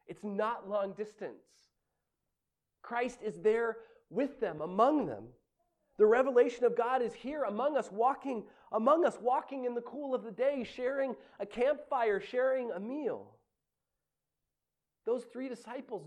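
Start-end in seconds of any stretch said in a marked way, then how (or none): 2.8-13.4 raised, building preacher's cadence, slowing at the end; impassioned preaching. Acted. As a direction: preach it with rising fervor.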